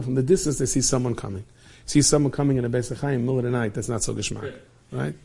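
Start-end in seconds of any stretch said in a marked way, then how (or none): none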